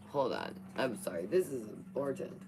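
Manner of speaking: ordinary talk, nothing marked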